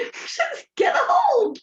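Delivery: high, breaking laugh through the words; can barely get it out for laughing. Real, not acted. Speaker laughing at her sister's blunt remark, high and breaking.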